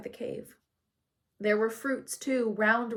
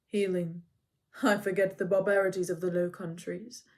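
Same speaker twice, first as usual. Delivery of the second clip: even, factual centaur voice; lightly voiced calm centaur; neutral and calm; 3 semitones lower than usual. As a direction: state it plainly and calmly. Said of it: "His voice was neutral and calm, factual".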